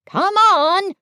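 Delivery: high, loud hooting call. A narrator's reading. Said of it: "called Owl".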